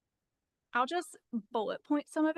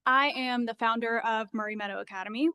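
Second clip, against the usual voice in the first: nervous, shaky voice, louder; 9 dB louder than usual. Real, not acted. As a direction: voice trembling with nerves. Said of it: "sorry if my voice is shaky".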